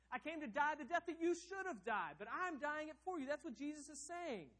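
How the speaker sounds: high, loud preaching, the last words slowed; fiery pulpit preaching; preaching at full force. Acted. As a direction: preach it with rising fire. Voices Jesus' message while preaching.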